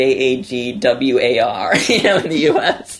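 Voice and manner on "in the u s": ordinary talk, nothing marked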